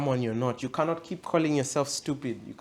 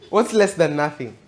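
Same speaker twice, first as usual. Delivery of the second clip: "What's less than nothing" louder and higher; emphatic repeat; 4 semitones higher, 8 dB louder than usual. Real, not acted. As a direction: hammer the question home, punching "less".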